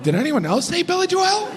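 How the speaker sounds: very high, fretting voice; high panicked worry. Retold or imitated. Comic doubting his own pronunciation, high anxious fretting. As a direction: spiral into paranoid doubt.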